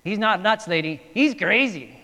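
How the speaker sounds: put-on Short Round voice drifting into an Indian accent; accidental Indian accent. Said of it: "my Indian accent got into that".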